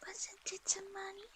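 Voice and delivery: high breathy whisper. Pretend. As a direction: whisper the phrase playfully. Whispered repetition.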